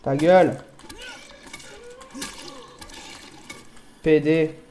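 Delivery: angry shouted insults at a game; raging gamer. Real, not acted. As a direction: yell abuse at the screen. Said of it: "Je l'ai insulté".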